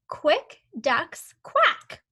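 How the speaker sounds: slow, deliberate word-by-word; slow read-along. Shown as a demonstration. Teacher reading along with the pupil, slow and clear. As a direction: read it slowly so the child can follow. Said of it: "that sentence says".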